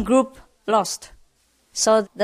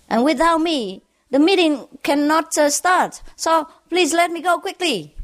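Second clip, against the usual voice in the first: raised pitch, fast and insistent at the start; self-important driver; 7 semitones higher than usual. Retold or imitated. Driver pressing the policeman to let him go, pitched up and pushy. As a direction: puff yourself up and demand to be let go.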